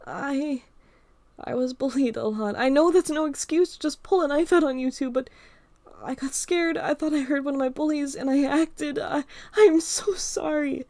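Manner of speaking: high, loud, frantic Emma voice; frightened girl apologizing for the knife; panicked apology. Acted.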